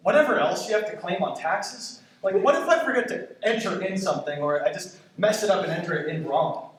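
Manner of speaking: usual speaking voice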